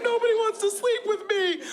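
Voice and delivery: high, whiny mock lament; hammed-up self-pity in a high voice; high put-on voice of a sad guy; whiny sad man. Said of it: "being like".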